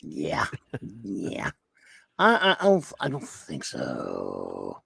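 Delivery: very low, slow drawn-out refusal; deep mock groan. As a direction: drop the voice down into a slow deadpan no. Hard pass on IMAX.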